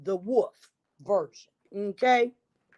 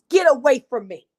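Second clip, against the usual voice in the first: much higher, louder and fast in haughty anger; indignant outburst; 5 semitones higher, 6 dB louder, faster than usual. Acted. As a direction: snap back, offended and proud. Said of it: "cried the rainbow fish".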